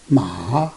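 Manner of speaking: long "Ma" dipping very low, then rising; third tone. Shown as a demonstration. Teacher reading the four tones, third "Ma" down then up. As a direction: sink "Ma" deep and lift it. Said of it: "read those four tones all together".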